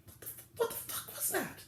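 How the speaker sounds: rising confused stammer that drops to a whisper; mock stunned reaction, trailing into a whispered what the fuck; imitating his own baffled reaction to the scene; baffled disbelief. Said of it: "you're just like".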